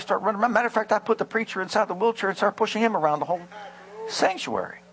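high, fast retold rush of excitement; excited testimony. Healed man tells pastor of his wheelchair antics, excited. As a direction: imitate a healed man racing through his story.

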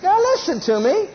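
rising, urgent call to attention; preacher's high-pitched plea for attention; high preaching call. Real, not acted. Preacher calling congregation to attention.

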